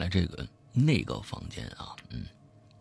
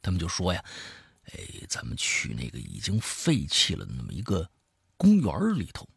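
lively storyteller swinging between hushed and emphatic; spoken horror-story narration; dramatic storytelling; 5 semitones higher, 4 dB louder than usual. A narrator's reading. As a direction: tell a creepy tale with punched key words. Tells a horror story aloud.

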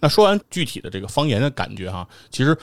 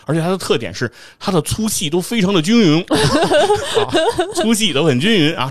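笑着模仿朋友夸挂面; 憋不住笑着复述; 边说边笑; 9 semitones higher, 4 dB louder than usual. Real, not acted. 转述朋友夸挂面忍不住笑. (忍着笑说)

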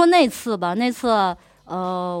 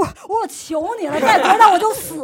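higher, louder gasping plea; mock out-of-breath pleading; breathless begging; 7 semitones higher, 3 dB louder than usual. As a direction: beg for mercy while being tickled. Tickled breathless, begging to stop.

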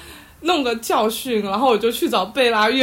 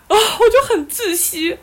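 mock despair; 9 semitones higher than usual. Real, not acted.

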